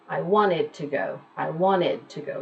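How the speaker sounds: "wanted" with the T swallowed, wanna-d; American wanted. Shown as a demonstration. Teacher demos American "wanted" for students. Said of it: "American English people saying wanted".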